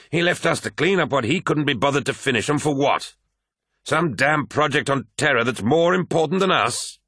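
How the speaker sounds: high-pitched bitter tirade; raging. A narrator's reading. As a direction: spit out the grievance, building indignation. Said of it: "raged Abaddon".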